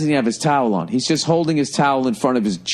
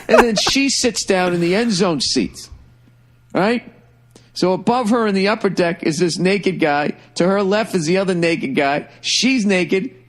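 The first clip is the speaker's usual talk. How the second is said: higher, giggly storytelling; laughing while telling; 8 semitones higher than usual. Real, not acted. Teller narrates the naked woman scene still laughing. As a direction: keep telling it through the giggles.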